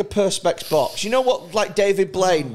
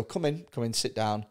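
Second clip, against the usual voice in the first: soft, lower mock-polite interviewer; 7 semitones lower, 10 dB quieter than usual. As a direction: welcome him in quietly and falsely nice. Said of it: "they'd go".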